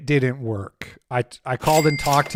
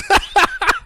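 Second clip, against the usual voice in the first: sudden high-pitched laugh; high burst of laughter; 5 dB louder than usual. Real, not acted. Streamer cracks up at his own mock prayer, laughing high.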